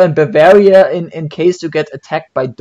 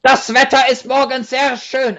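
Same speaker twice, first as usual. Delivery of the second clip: mock harsh German; 7 semitones higher than usual. Shown as a demonstration. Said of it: "I can say".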